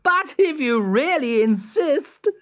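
high, condescending character voice; cocky high-voiced hare; sneering hare. A narrator's reading. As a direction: give in scornfully, pitched high. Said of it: "sneered Hare".

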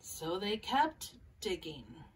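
gentle picture-book read-aloud with small character voices; calm storybook reading for young listeners; reading to children. Acted.

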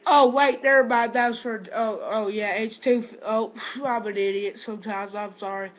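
ordinary talk

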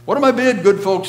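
high, loud auctioneer call; auctioneer's cry. A narrator's reading. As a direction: shout to the crowd like an auctioneer. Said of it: "he cried".

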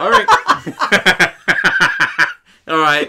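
high, loud laughing through words. Real, not acted. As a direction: laugh while getting the words out.

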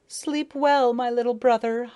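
grieving farewell cry; tearful cry. A narrator's reading. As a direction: call a last goodbye to the dead brother. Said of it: "I cried".

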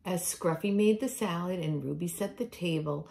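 plain reading voice

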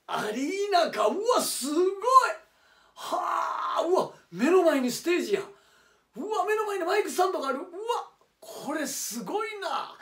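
high, loud cries of amazement; thrilled fan shouting at the sight of the arena; excited shouts. Acted. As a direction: shout with awe at the stage right in front of you. Awed at the front-row seats.

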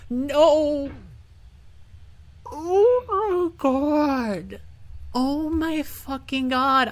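high, loud, drawn-out wailing; anguished wail. Real, not acted. Player sent back down the mountain, anguished wail. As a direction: wail slowly in despair at the loss. Loses progress in the game.

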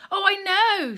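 weeping. Acted. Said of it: "she wept".

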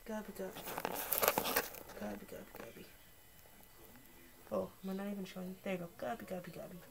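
quiet coaxing call to a pet; soft calling. Real, not acted.

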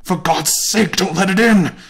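put-on old man crying out in dread; trembling cry. A narrator's reading. Old man begging wife not to open door, trembling cry. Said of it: "cried the old man, trembling".

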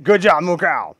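high, loud cheer; loud praise. Real, not acted. Player cheering on his cow, loud excited praise. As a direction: praise it loudly as it finally makes it.

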